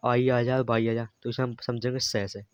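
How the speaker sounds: normal talking voice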